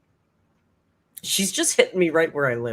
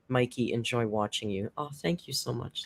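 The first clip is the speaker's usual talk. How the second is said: ordinary talk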